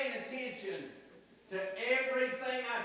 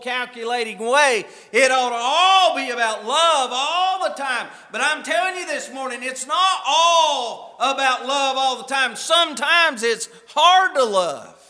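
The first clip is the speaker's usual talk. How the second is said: full-force preaching; 15 dB louder than usual. Real, not acted. Preacher charging husbands to love their wives, full-force preaching. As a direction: drive each phrase home from the pulpit.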